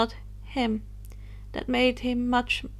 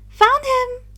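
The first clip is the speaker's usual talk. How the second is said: much higher, louder cry of joy; happy exclaim; 13 semitones higher, 8 dB louder than usual. Acted. Luna announcing she found Harry, high happy shout. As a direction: shout it out, delighted. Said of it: "Luna exclaimed happily".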